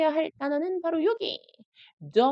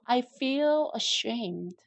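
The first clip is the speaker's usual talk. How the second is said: very slow, clear model sentence; slow drill reading; much slower than usual. Shown as a demonstration. Teacher reading slowly for students to repeat. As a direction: read it slowly for learners to repeat. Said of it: "예, 자기가 그렇게 느끼는 거예요".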